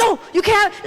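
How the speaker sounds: high, loud mock refusal; panicked father refusing. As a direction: play a dad shutting the child down. Imitates panicked father refusing child.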